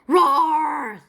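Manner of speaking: loud, high-pitched sea monster roar. A narrator's reading. Sea monster roaring back at the princess, loud roar. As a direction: bellow it out like a beast. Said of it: "said the mo-, sea monster".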